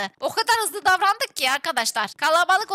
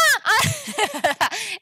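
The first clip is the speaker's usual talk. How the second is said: high bursting cackle; laugh; 4 semitones higher than usual. Real, not acted. Laughs at a surprise. (şaşkın kahkaha patlatma)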